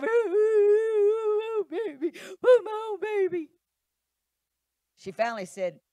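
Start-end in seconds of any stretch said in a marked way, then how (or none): 0.0-3.5 mock crying high loud coaxing, slow; hammed-up tearful baby come on; cooing plea. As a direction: wail it like a pleading wife. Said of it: "she's like".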